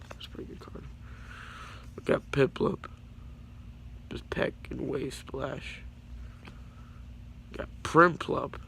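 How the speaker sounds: ordinary talk, nothing marked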